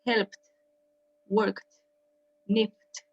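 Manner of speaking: "Helped, worked, sniffed" each ending in a crisp T sound; teacher's model of final T in "Helped, worked, sniffed". Shown as a demonstration. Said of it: "como una T al final".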